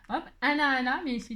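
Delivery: usual speaking voice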